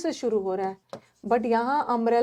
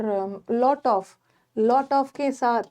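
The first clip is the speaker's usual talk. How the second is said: ordinary talk, nothing marked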